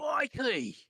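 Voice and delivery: loud high Australian "Crikey!"; Steve Irwin crikey. Retold or imitated. Mimicking Steve Irwin, a loud Australian "Crikey!".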